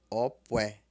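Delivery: "Opué" with low O and mid pué; low-mid Yoruba tones. Shown as a demonstration. Teacher repeats December for learners, low-mid tones. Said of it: "Tom baixo e tom médio".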